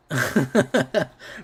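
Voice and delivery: short burst of high laughter. Real, not acted. Joking about a friend's trip plans, laughing. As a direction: laugh out loud in quick bursts.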